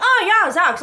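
high, loud fake-casual oh yeah; sarcastic eye-roll delivery; mock-breezy reply; sarcastic mock agreement. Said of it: "As if I'm just supposed to be like".

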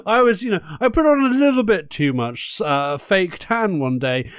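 high, loud camp diva greeting; campy drag queen. Acted. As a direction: gush like a flamboyant hostess. Campy host persona boasting.

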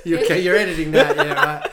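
high, loud, laughing tease. Real, not acted. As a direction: tease while cracking up. Teasing over the bald joke.

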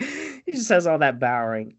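ordinary talk, nothing marked

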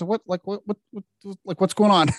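high, halting imitation of bewilderment; mildly baffled. Manager puzzled at kid being led away, mildly baffled.

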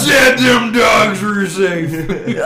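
mock angry shout. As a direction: ham up the furious dad.

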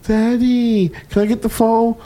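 put-on high little-kid voice asking for the phone; dad imitating his toddler son begging for the phone. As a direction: mimic a sweet toddler begging. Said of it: "he comes to you".

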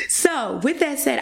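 ordinary talk

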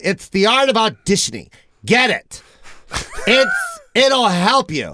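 high nasal-free Lovitz bark, rising to a shout; Jon Lovitz impression. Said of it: "That sounds like a combination of, uh, John Liv-, John Lovitz and Gilbert Godfrey".

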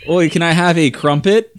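comically hammed-up Brit; mock British polite request; bad British accent. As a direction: put on a silly British accent. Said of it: "This is bad British accent".

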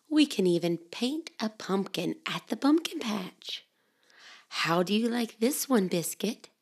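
soft hushed storybook whisper; whispered story reading. Acted. Mother reading to children in a soft whisper. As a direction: hush it like a bedtime book.

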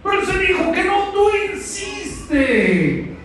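rising, insistent sermon challenge; preaching at full force. Acted. Preacher urging the congregation to insist, fervent preaching. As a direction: push the congregation not to give up.

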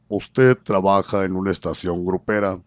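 low, stern-boss impression; don Manuel Hurtado. Station manager confronting his new host about the music, imitated in a low voice. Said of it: "veo a don Manuel Hurtado".

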